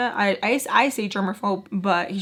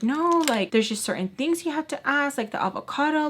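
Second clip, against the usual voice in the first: imitated complainer; 6 semitones higher than usual. Imitated girl argues back.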